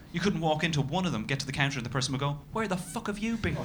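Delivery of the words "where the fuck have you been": high mock outrage; angry barista. Imagined coffee shop worker scolding a customer, high mock outrage. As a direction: bark at the customer like a cabbie would.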